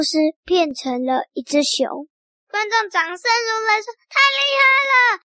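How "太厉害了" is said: excited cheer. Acted. Audience cheers the trick.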